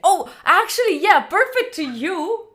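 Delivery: high, loud burst of agreement; delighted realization. Real, not acted. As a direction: exclaim it high and loud, drawing out the end. Delighted realization after a compliment.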